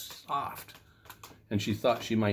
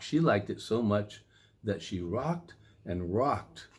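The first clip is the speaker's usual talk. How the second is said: usual speaking voice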